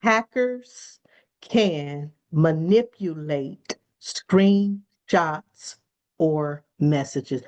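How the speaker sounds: "Hackers" set apart, then "can manipulate screenshots" drawn out very slowly; slow, deliberate repeat; repeated slowly for emphasis. Shown as a demonstration. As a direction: spell it out word by word so it sinks in. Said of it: "Let me repeat that one more time".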